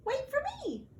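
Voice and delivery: very high little mouse cry; mouse calling. Acted. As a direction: call out like a tiny mouse left behind. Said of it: "cried Mouse".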